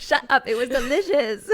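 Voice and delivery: laughing through the words. Real, not acted. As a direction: giggle while protesting. Laughing retort to teasing friend.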